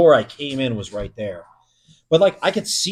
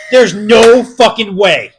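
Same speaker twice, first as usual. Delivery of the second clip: slow, loud and higher, each word dragged; loud disbelief; 7 semitones higher, 12 dB louder, slower than usual. Retold or imitated. Guard refusing to believe what he hears, slow loud protest.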